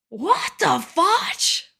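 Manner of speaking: high, drawn-out disbelieving exclamation; shocked outburst. Real, not acted. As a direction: stretch it out in high-pitched disbelief. Reacting to shocking story she reads.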